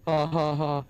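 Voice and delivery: flat mock laugh; sarcastic laugh. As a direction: fake sarcastic ha ha ha. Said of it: "Make fun of the guy".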